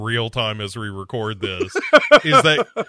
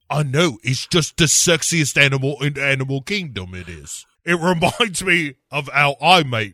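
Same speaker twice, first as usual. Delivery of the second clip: put-on cockney girl chatter; mock cockney; 3 semitones higher, 3 dB louder than usual. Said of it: "[name] here chimes in".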